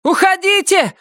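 very high urgent scream; shouting. A narrator's reading. Доркас ordering them both away, shouting. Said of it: "закричала Доркас".

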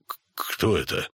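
low, halting stammer. A narrator's reading. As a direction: stumble on the first sound. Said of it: "заикаясь". (запинаясь, низким голосом)

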